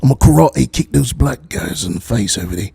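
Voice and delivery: mock British tough-guy threat; Jason Statham. Threatening karate kicks, hammed-up impression. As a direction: play a British action hard man. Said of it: "Maybe some Jason Statham racism".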